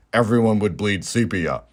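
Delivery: ordinary talk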